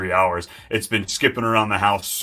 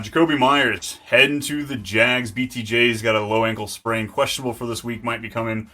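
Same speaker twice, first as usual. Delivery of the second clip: ordinary talk, nothing marked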